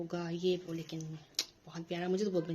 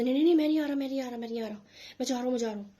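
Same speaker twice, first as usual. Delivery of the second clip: put-on loud, higher protest of a scared boy; panicked boy; 5 semitones higher, 5 dB louder, much faster than usual. Caught schoolboy refusing to come over, mock panicked. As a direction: backpedal frantically like a caught kid. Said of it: "वो बच्चा एकदम से ना कहते".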